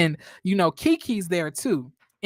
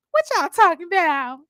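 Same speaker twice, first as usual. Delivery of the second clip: higher, louder nosy butting-in voice; Melody imitation; 8 semitones higher, 5 dB louder than usual. Recapping a reality show, mimicking Melody barging in high and nosy. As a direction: pop in high and loud, all curious.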